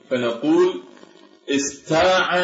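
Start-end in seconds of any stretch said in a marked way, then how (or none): 1.5-2.4 "استاع" as istaa'a with plain ت; light ت. Shown as a demonstration. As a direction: swap the heavy ط for a light ت in "استاع". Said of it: "يعني نقلب الطاء إلى تاء". (نطق "استاع" بتاء مرققة)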